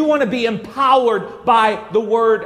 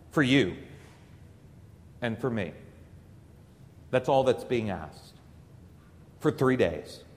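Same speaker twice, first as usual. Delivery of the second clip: hushed and solemn; 11 semitones lower, 10 dB quieter than usual. Real, not acted. Priest drops to a hush for the congregation, soft and grave. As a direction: let it sink in, almost under your breath. Solemn point about the cross.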